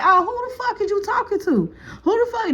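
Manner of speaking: high, outraged who the fuck; angry confrontation. Retold or imitated. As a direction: get in his face, indignant. Girl confronting him, in his face.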